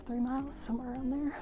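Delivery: usual speaking voice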